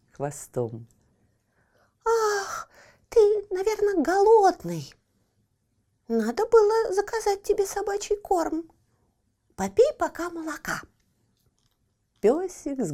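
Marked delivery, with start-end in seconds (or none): 2.1-10.8 sympathetic. Acted. Cat pities the hungry puppy.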